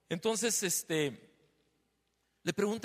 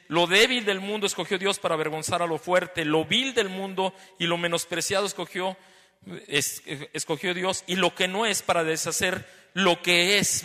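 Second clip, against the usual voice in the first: preaching scripture; 7 dB louder than usual. Acted. Preacher quoting Corinthians to the congregation, loud preaching. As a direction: proclaim the verse with full force.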